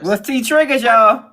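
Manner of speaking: high, loud playful shout of the question; excited call-out. Acted. Co-host echoes question to the chat, high and loud. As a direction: hype the crowd with the question.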